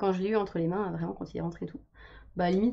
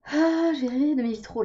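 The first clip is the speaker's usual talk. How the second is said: excited; 7 semitones higher, 7 dB louder than usual. Real, not acted. Sudden delighted exclamation.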